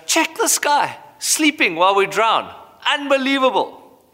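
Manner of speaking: high, incredulous exclaim; disbelief. Retold or imitated. Disciples in a storm complaining about Jesus, incredulous. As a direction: voice the disciples' outrage at the sleeping man.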